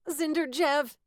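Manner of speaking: high, loud blurt. A narrator's reading. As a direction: blurt it out urgently. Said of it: "someone blurted".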